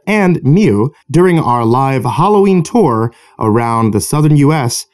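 bright spooky-host ad read; cheery host outro. Acted.

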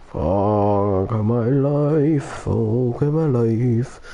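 very slow, dragged-out lament; slow despairing drawl. Real, not acted. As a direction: stretch each word out in despair. Drawn-out groan of despair.

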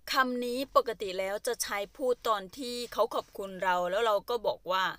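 usual speaking voice

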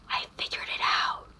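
very soft, awed whisper; gasp, then whisper the discovery. Acted. Student suddenly works out the answer, gasped whisper.

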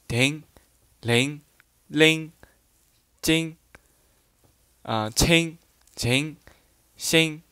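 each example syllable said slowly and separately; dialect readings. Shown as a demonstration. Teacher reads dialect words to the learners, carefully. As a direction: read the dialect sounds out one by one. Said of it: "举一下例子".

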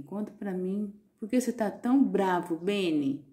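usual speaking voice

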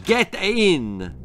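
a mild growled order. A narrator's reading. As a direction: gunman growls at Daffyd. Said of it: "growled the man with the gun".

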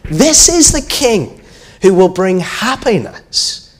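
high, drawn-out crowd acclamation; joyful crowd shout. A narrator's reading. Crowd hailing the promised king, shouting. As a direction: call it out high and slow to everyone. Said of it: "they shouted".

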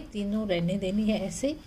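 ordinary delivery

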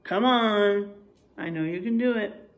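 ordinary delivery

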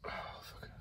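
a breathless whispered oath; whispered curse. Real, not acted. Vlogger alone in his van, whispering in disgust. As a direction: let it slip out on a whisper. Despairing after the incident.

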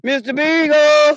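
long call for the missing dog. Real, not acted. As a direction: stretch the name out across the woods. Calling for lost dog.

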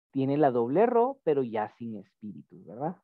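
normal talking voice

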